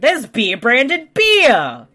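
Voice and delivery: high, loud, drawn-out complaint; loud exasperated protest, stretched out; exasperated outcry. Acted. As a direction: cry it out slowly in disbelief. Mock outrage at product placement.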